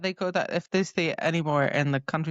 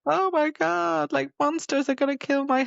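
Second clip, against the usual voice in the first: mock panic; 10 semitones higher than usual. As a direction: exaggerate a horrified "oh my god". Retells a character's monster panic.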